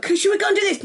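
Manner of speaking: high, keen imitated question; mock a friend full of ideas; eager suggestion. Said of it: "it was like".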